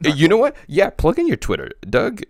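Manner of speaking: loud, high-pitched interjection; emphatic outburst. Real, not acted. Host urging Doug to plug his Twitter, loud outburst.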